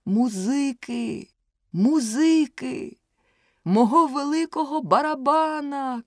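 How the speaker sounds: high, pleading shout for music; desperate cry. A narrator's reading. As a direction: call out for the drum in panic. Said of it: "кричав він".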